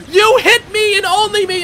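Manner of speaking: high, loud, indignant complaint; whiny outcry. Real, not acted. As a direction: wail it like you've been betrayed. Teammate hits player in game.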